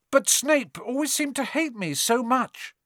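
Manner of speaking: put-on high, puzzled boy; protesting. A narrator's reading. Harry keeps Quirrell talking, protesting.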